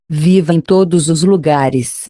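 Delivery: usual speaking voice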